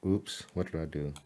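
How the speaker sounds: soft, low startled mutter to self; quiet surprised oops at the screen. Real, not acted. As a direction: catch a slip under the breath. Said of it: "I was like".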